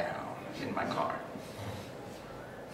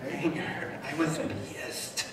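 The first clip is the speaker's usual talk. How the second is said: a soft, breathy "Anger"; barely voiced "Anger"; hushed answer; 4 semitones higher, 6 dB quieter, slower than usual. Shown as a demonstration. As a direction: say "Anger" under your breath. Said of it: "Anger, right?".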